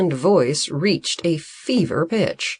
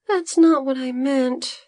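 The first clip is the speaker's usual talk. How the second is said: muttering; 9 semitones higher than usual. A narrator's reading. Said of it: "Bodo muttered".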